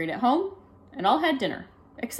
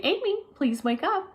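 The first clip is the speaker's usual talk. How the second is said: higher, sweet and gentle plea; very nicely; 10 semitones higher than usual. Acted. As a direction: coax her awake nicely. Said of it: "said very nicely".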